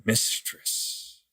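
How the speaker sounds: soft rasping creature whisper; frail breathless old person. Acted. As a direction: breathe it out, barely voiced. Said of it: "He said".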